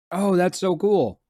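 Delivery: loud, slow, delighted gasp; gasp, then savor it with delight; excited gasp. Real, not acted. Excited by lore.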